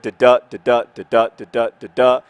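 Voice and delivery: "da-DA" in a steady beat, stressing the second; iambic pentameter rhythm. Shown as a demonstration. Showing viewers how the line sounds, "da-DA" stressed in even pairs. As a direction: chant "da-da" five times, each second DA loud. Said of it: "you emphasize the second syllable".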